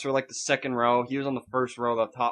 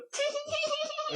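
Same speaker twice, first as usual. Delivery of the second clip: tiny, very high squeaky titter; creepy high squeak made with the mouth; imitated comedian's noise; 7 dB quieter than usual. Wanting to creep out moviegoers, high squeaky titter. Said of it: "I just wanna go".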